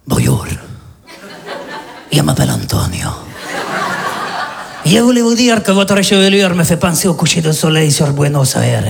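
low Latin lover voice; smooth low seduction with a Spanish accent; deep accented charm; Spanish seducer accent. Acted. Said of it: "je fais".

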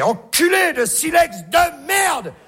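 high, slow, furious yell; caveman yelling. Acted. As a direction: curse the stone like a brute. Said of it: "il faisait". (caveman qui hurle, aigu et lent)

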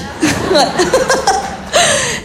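high burst of laughter; a high giggling laugh. Real, not acted. Comic laughing at her own joke, high giggle.

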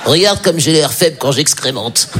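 slow, pathetic little voice for the trembling dog; slow, pitiful voice of a dog caught mid-business. Pretend. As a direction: make the dog sound feeble and ashamed.

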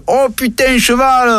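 cheerful southern French drawl; southern French accent. Acted. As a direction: greet him with a big southern French "putain". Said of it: "c'est un âne du sud, faut le savoir".

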